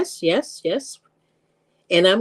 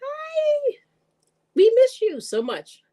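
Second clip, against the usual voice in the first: excited; much slower than usual. Real, not acted. Greets the returning viewer.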